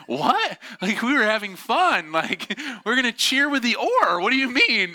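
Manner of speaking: high, loud mock disbelief; incredulous protest. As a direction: act out a baffled rafter objecting. Alarmed rafters protest to guide.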